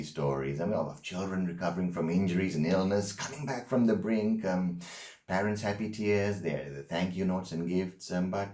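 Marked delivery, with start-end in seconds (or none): none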